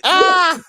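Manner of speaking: very high, loud squeal of laughter; helpless laughing shriek. Real, not acted. Cohost losing it over the joke name, shrieking with laughter.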